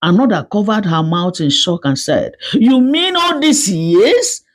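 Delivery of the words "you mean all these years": shocked disbelief. Acted. Woman shocked at the confession.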